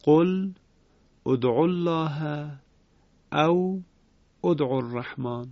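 قل ادعوا الله joined as qulud'u, with damma; Ibn Kathir reading. Shown as a demonstration. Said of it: "همین قاعده".

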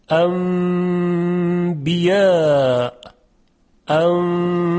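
انبیا chanted slowly, n turned to a held nasal m; Quranic recitation. Shown as a demonstration. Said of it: "تلفظ صحیح هون اینطوریه".

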